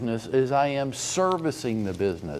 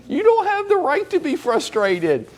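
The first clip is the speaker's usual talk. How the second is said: high, louder rebuke; scolding; 13 semitones higher, 3 dB louder than usual. Real, not acted. Coach scolding agents who won't work, raised voice. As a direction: tell them off hard.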